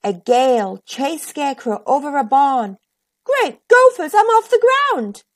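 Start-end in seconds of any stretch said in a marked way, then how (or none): 3.2-5.2 high, loud excited cry; thrilled shout of delight. A narrator's reading. Scarecrow thrilled, lifted off.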